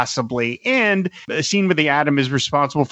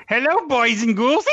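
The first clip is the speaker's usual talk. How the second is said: high cackling ghoul host; Crypt Keeper voice; 8 semitones higher than usual. Retold or imitated. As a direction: greet them like a gleeful ghoul. Crypt Keeper impression, horror puns.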